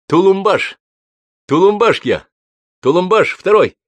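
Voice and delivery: the husband booming his name at a guest; booming introduction. Acted. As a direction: announce your name like a proclamation. Groom introduces himself booming.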